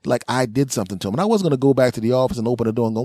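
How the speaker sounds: normal talking voice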